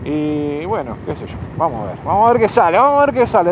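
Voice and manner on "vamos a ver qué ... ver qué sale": alto y animado, con ganas; entusiasmado. Real, not acted. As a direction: decirlo con ilusión de aventura. Arranca animado por el camino. (animado, con ilusión por lo que viene)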